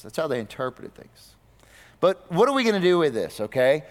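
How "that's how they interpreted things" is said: "That's how they interpreted things" repeated much softer, as an aside; softer echo. Shown as a demonstration. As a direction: drop the repeat to a soft, pointed echo. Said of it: "so that's how they interpreted things".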